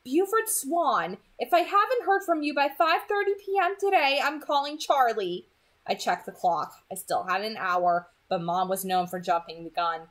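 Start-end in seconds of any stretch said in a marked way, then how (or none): 0.0-5.5 mom laying down the law; full-name scolding from mom; stern mom warning. A narrator's reading. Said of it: "mom's ultimatum".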